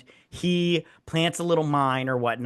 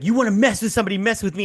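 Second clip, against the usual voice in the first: louder, higher tough-guy challenge; 6 semitones higher, 4 dB louder, much faster than usual. Retold or imitated. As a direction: challenge like an action hero. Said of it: "he's like".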